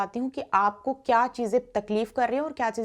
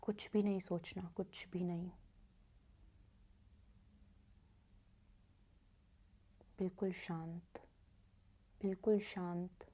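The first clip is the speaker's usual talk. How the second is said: guided meditation; 2 semitones lower, 6 dB quieter than usual. Acted. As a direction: guide listeners gently into stillness, softening on repeated phrases. (धीमी, शांत ध्यान कराने वाली आवाज़)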